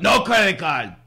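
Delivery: put-on high, loud Greek-accented refusal; old Greek man. Greek rental owner refuses a tourist's card, emphatic Greek accent. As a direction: bat the customer away in an emphatic Greek accent. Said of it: "an old Greek man that will either punch".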